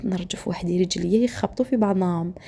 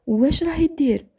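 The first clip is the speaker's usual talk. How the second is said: higher-pitched retold angry demand; mock interrogation, raised pitch; angry questioning; 8 semitones higher, 4 dB louder than usual. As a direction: question sharply as the angry husband, pitch jumping up. Angry husband interrogates the narrator.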